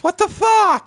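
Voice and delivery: high, loud outraged scream; retold yell. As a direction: yell it up the stairs in shock. Retells yell from stair bottom.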